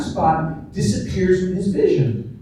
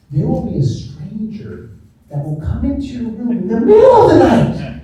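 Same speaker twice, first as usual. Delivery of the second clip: dramatized parental tale, swelling louder and higher; mock-ominous mother; 5 semitones higher, 6 dB louder than usual. Mother telling her child about a nightly stranger, mock-ominous. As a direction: build from hushed setup to high, loud absurd peak.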